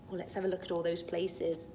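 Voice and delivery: ordinary delivery, nothing marked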